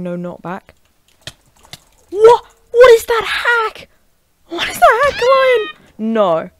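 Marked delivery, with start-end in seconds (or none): 2.1-5.7 very high, loud screech of disbelief; outraged screaming. Real, not acted. Gamer accusing his opponent of hacking, outraged scream. 6.0-6.5 loud, emphatic refusal; loud protest. Real, not acted. Protesting after losing to hacker.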